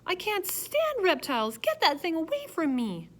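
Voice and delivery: high, loud panicked woman; woman shrieking as the turtle is held under her nose, high and loud. A narrator's reading. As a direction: recoil in horror from a reptile.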